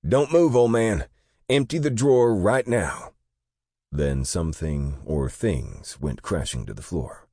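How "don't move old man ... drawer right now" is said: high, loud robber's shouted threat; shouted demand. A narrator's reading. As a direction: bark the demand at the clerk. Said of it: "a demand shouted by a male voice".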